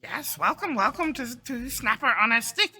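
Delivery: high-pitched eager shopkeeper creature; welcoming kobold. Acted. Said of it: "And this kobold goes".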